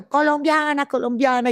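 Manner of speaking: high mock shout of Colombiana; shouting a name. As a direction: call her name from far below. Inmates shouting from below. (grito imitado de los presos, agudo)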